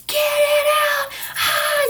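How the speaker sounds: very high, loud mock shriek of labor pain; imitating a birthing scream. Vlogger imitating a woman screaming in childbirth. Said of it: "perpetual high-pitched screaming".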